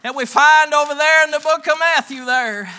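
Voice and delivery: preach. Real, not acted. Preacher pointing congregation to Matthew, preaching loudly. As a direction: preach it out forcefully and high.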